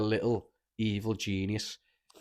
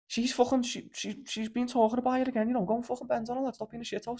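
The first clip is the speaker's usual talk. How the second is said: squeaky mocking voice, much higher; mock high-pitched mate; 14 semitones higher than usual. Mate pushing his friend to ask her out, high mocking voice.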